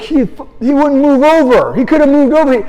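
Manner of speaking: he insists it's the other guy's fault; ranting runner. Retold or imitated. Runner defending himself to the coach, high indignant rant.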